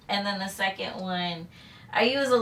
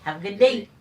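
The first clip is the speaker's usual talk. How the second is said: Eddie Murphy quote; 5 dB louder than usual. Retold or imitated. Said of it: "Eddie Murphy was like".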